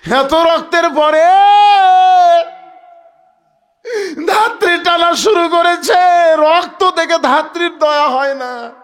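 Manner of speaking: loud, high, tearful cry; anguished preaching. Acted. Preacher lamenting the blood shed, anguished cry. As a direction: wail it out over the crowd.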